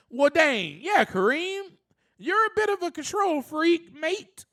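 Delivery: lightly mock British, teasing himself; bad English accent. Teasing himself in a lightly put-on English accent. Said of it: "That's my, uh, English accent. It's very horrible".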